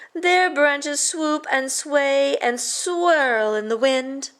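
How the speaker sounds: slow dreamy teacher; drawn out and lilting; slow, swaying. Acted. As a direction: stretch it slowly like swaying branches. Teacher describing willows.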